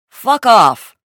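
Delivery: loud, high, easy brush-off; tossed back. Acted. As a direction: toss it back with a grin. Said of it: "Sean tossed back easily".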